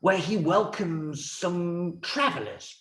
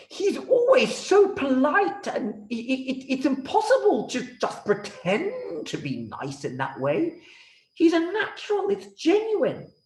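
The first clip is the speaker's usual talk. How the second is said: much higher, insistent king; 11 semitones higher than usual. Acted. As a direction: defend him in a high royal voice. King defends rabbi, high voice.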